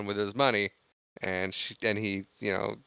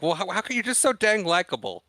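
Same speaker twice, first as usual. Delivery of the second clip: higher-pitched mock complaint with a flirty edge; playful flirty teasing; 9 semitones higher than usual. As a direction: tease him playfully in a raised pitch. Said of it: "just making fun of him, playfully and flirty".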